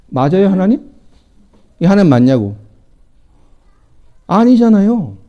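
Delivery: usual speaking voice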